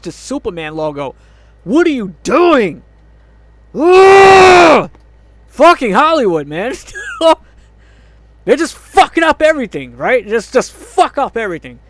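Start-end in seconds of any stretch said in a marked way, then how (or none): 1.6-2.8 higher, louder, drawn-out demand; exasperated shout. Real, not acted. Fan scolding the makers for changing the logo, exasperated. 3.7-4.9 long, high, very loud groan; frustrated yell. Real, not acted. Fan fed up with the logo change, yelling in frustration. 5.5-11.8 raised, high, loud outburst; angry rant. Real, not acted. Fan blaming Hollywood for fucking up everything, angry.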